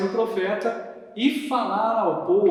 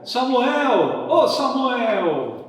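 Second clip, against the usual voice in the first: loud call across the room; 6 semitones higher, 6 dB louder, much slower than usual. Real, not acted. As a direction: call his name out loud. Calling the puppet to come.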